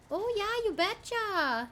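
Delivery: high, loud, drawn-out Minnesotan; chirped Minnesotan, stretched at the end; Minnesotan accent. Shown as a demonstration. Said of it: "like, a Minnesotan accent".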